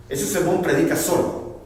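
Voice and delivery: ordinary delivery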